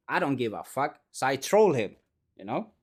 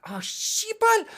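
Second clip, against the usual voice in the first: high, drawn-out mock outburst; shocked curse; slower than usual. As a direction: imitate a horrified swear, voice leaping up. JD's outburst on getting the joke.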